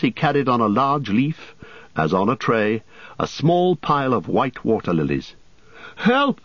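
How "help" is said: high, urgent call for Help; frantic cry. A narrator's reading. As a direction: shout Help in a panic. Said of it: "cried Frodo and Sam".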